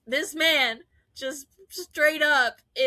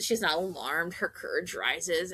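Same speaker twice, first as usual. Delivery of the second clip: usual speaking voice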